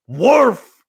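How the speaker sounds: loud barked punchline, stretched slow; slow loud barking punchline; dog bark punchline. Acted. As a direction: bark it out like the dog's big answer. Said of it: "the dog goes".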